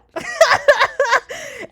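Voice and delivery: a sudden high burst of laughter. Real, not acted. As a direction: laugh out loud, real and high. Laughs at her own habit.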